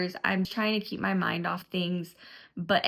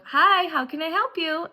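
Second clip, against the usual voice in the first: high, louder, sing-song receptionist impression; mock the nurse's canned greeting; mimicking the intercom nurse; 9 semitones higher, 6 dB louder than usual. Telling viewers how the intercom answered, high sing-song mimicry. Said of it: "they go".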